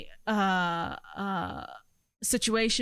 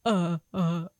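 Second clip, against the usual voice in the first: mock hesitant mumble; 6 semitones lower than usual. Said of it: "You were like".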